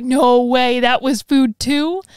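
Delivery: loud, drawn-out astonished reaction; mind-blown disbelief. Retold or imitated. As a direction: relive being amazed, stretching it out loudly. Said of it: "it blew my fucking mind".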